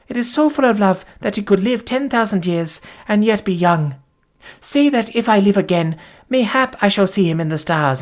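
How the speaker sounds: put-on wounded girl telling what happened; dying young woman. A narrator's reading. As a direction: tell it as your strength ebbs. Voices the dying Folata.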